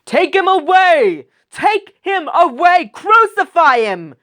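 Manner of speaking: very high, loud screaming demand; crowd shouting. Acted. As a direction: scream it like a raging mob. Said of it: "But they shouted".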